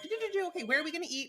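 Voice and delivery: high, sing-song mock busyness; imitated breezy chatter. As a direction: chirp along obliviously, imitating a friend. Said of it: "you just kept talking".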